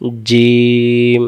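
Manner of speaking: জিম stretched very long; slow drawn-out jim. Shown as a demonstration. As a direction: hold জিম slowly. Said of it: "আবার বলেন". (জিম খুব টেনে লম্বা করে)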